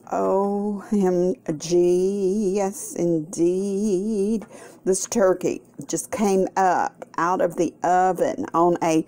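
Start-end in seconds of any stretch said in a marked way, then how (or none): 0.1-4.4 very slow, drawn-out O M G; savouring OMG. Real, not acted. Cook relishing the juicy turkey, very slow OMG. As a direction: stretch every letter out in pleasure.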